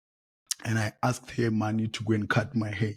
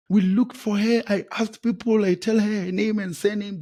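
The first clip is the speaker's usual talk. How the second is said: higher and louder, worked up; frustrated; 8 semitones higher, 3 dB louder than usual. Retold or imitated. Searching the hospital for the injured girl, frustrated. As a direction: retell the fruitless search with rising anger. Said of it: "Yo, I was so mad".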